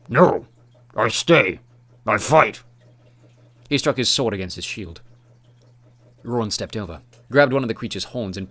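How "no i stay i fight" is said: loud, blunt monster speech; thick Urgle accent. A narrator's reading. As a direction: bark out short words like a brute. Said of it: "said in a thick accent that Roran barely understood".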